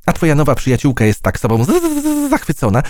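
high, fast teasing stutter on z-; mock stammer. A narrator's reading. Fly mocking the butterfly, stuttering high. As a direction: stumble over every z on purpose.

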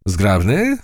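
Zgrabny said high with an offended rise; offended echo. A narrator's reading. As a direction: toss Zgrabny back, a bit insulted. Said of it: "oświadczył kosarz".

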